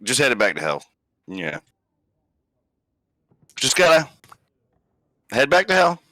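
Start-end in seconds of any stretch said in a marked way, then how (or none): 3.5-6.0 higher, half-hearted line; half-hearted retry. Acted. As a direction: go up in pitch but only half commit. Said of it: "not fully going with that".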